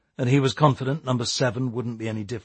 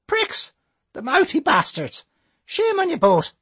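put-on old Irish lady voice; 4 dB louder than usual. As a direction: scold like a cross granny.

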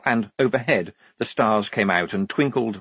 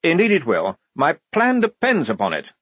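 higher, self-satisfied lieutenant; proud; 7 semitones higher, 4 dB louder than usual. A narrator's reading. Lieutenant pleased with his plan, proudly. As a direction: show pride in your own plan. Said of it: "said Blouse proudly".

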